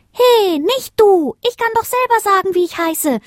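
very high, squealed protest; indignant little star. A narrator's reading. Smallest star interrupts her sister, indignant. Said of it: "regte sich das kleine Sternchen auf".

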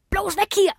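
high-pitched yelp to flee; panicked cry. A narrator's reading. A wolf screams to flee the dogs, panicked. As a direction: cry out high to get everyone away. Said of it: "schrie ein anderer".

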